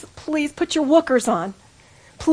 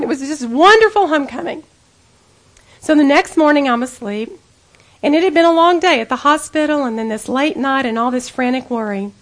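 ordinary talk, nothing marked

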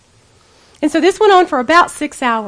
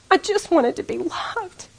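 soft, slow, raised-pitch lament; aching yearning; 5 semitones higher, 5 dB quieter than usual. Real, not acted. Speaker after seeking love from men and success, soft aching confession. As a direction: confess it in a small, longing voice.

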